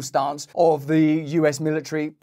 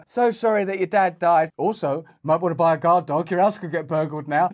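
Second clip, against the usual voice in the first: mock sympathy. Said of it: "You'd never do that to an individual".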